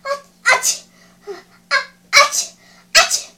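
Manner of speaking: high, loud "achoo" bursts; explosive mock sneezing fit; three acted sneezes. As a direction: gasp and sneeze three times. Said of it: "他又打了三个喷嚏".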